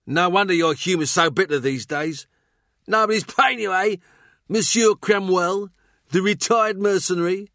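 high-pitched, mocking jester; goading fool's voice, pitched well up; taunting fool. A narrator's reading. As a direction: needle him in a high, jeering voice.

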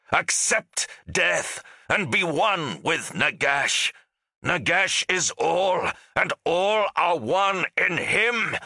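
high, slow, ringing proclamation; thundering. A narrator's reading. Ghost lord proclaiming his god over the battlefield, thundering. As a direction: thunder it out like a fanatic's creed. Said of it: "he thundered".

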